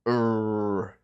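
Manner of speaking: loud growl; angry growl. Retold or imitated. Animator voicing the old draft, loud growl. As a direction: growl like a furious dog. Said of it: "This was like".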